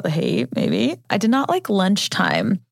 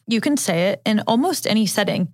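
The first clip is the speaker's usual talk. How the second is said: usual speaking voice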